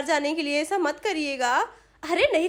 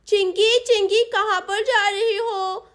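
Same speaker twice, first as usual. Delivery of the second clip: put-on raised child voice, words drawn out slowly; calling out; 4 semitones higher, 4 dB louder than usual. Sonam calls passing Chinki to stop, calling out. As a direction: hail a friend across the way, stretching it.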